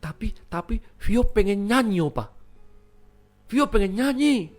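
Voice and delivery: put-on high-pitched pleading child voice; insistent small child. Vio begs Opa in the night, high and insistent. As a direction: plead like a toddler who cannot wait.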